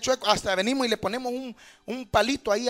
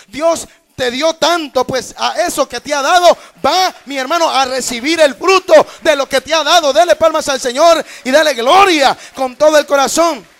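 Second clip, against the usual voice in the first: loud, fervent preacher's cry; loud fervent exhortation to give glory to God; preaching at full force; 3 semitones higher, 10 dB louder than usual. Acted. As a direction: rouse the congregation to clap. Preaching climax, congregation exhorted.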